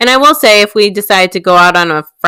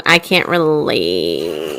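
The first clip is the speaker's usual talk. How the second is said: deadpan; 11 semitones lower, 5 dB quieter, much slower than usual. Real, not acted. Deadpan low drop.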